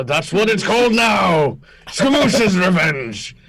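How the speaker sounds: declamatory captain, dragging out the final words; vengeful orc pirate captain. Acted. Pirate captain names his ship, vengeful theatrical declaration. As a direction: proclaim the grudge, then slow and drop at the end.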